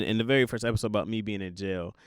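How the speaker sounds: ordinary talk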